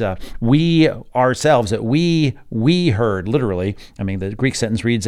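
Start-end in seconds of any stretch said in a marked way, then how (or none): 0.4-3.3 loud, drawn-out stress on each "we"; emphatic "we". Shown as a demonstration. Teacher showing the doubled Greek pronoun, emphatic "we". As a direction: hit "we" hard every time, louder and slower. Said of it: "it's very emphatic in this Greek sentence".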